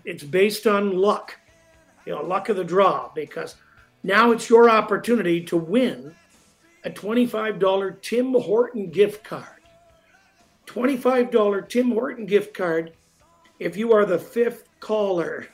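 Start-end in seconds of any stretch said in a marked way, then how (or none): 4.0-15.5 radio promo. Acted. Contest promo over music.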